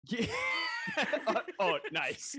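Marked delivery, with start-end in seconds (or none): 0.3-1.5 a burst of high laughter; high shrieking laugh. Real, not acted. Host cracks up at the cohost's story, shrieking laugh.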